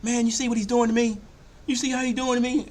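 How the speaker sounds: high, loud whiny complaint; complaining to the ref. Retold or imitated. Malone whining high and loud to the referee about the rough defense. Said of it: "complaining to the referees".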